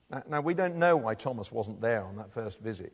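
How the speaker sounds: ordinary talk, nothing marked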